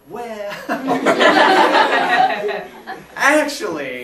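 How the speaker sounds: bursts into loud laughter mid-sentence; cracking up before the confession; laughing at his own arrests. Real, not acted.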